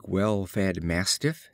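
plain reading voice

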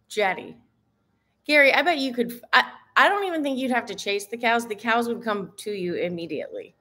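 normal talking voice

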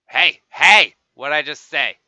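loud, sharp hey hey; hammed-up angry call; mock scolding shout. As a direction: shout it like a fed-up parent. Scolding an approaching player.

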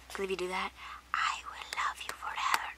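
unvoiced secretive whisper. Real, not acted. Youtuber whispering a plea to her viewers. Begging viewers to watch old videos.

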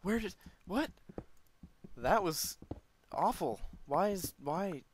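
high, loud startled bewilderment; bewildered, high-pitched outburst. Real, not acted. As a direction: blurt it out, taken aback. Startled by a dying creeper.

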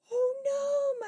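plain reading voice

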